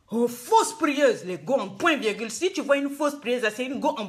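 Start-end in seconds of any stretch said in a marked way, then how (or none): 0.1-1.2 drawn-out scorn. Real, not acted. Mocking false prayer women.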